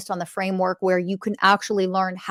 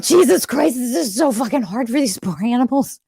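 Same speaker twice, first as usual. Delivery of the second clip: overwhelmed outburst; 4 semitones higher than usual. Retold or imitated. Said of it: "I'm like".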